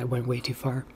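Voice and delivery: ordinary talk, nothing marked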